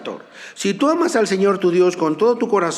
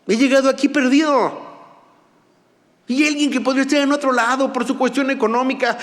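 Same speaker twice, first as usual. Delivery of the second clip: fervent preaching; 5 semitones higher than usual. Acted. Pastor urging the church to draw near, fervent preaching. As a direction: exhort the congregation with rising fervour.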